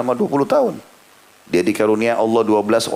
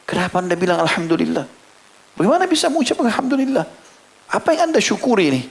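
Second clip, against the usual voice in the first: high, incredulous disbelief; astonished questioning; 9 semitones higher than usual. Retold or imitated. Stranger questioning a grieving father, astonished. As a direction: ask as if you can't believe it. Man stunned by father's praise.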